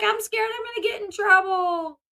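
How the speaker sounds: high, mildly wailing mimicry; imitated crying fear. Daughter afraid of punishment by father, mock tearful fear. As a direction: imitate a frightened teen in tears. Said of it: "When she breaks down crying".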